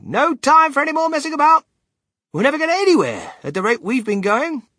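very high, loud hustling; Willy Wonka, impatient. Acted. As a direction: holler to hurry everyone up.

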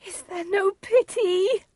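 very high, slow wail; distressed pleading. Acted. Juliet begs after father's threat.

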